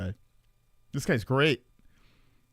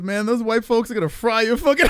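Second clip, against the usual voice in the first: laughing imitation; 7 semitones higher, 4 dB louder than usual. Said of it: "He goes".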